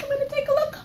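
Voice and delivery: ordinary delivery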